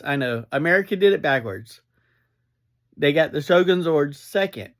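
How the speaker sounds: usual speaking voice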